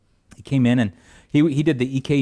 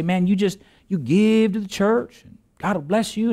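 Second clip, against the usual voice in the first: higher, hammed-up prosperity preacher pitch; mock prosperity preacher; 7 semitones higher than usual. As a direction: parody a TV preacher promising blessings. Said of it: "I wish I get up here and say".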